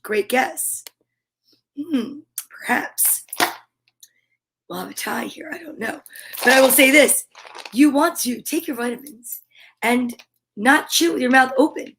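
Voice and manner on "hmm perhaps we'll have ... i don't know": suspenseful whisper. Acted. Suspenseful musing.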